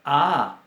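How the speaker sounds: "A" abierta y fuerte; vocal a. Shown as a demonstration. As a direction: abre bien la "A". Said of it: "como si fuéramos una vocal". ("A" vocal, fuerte)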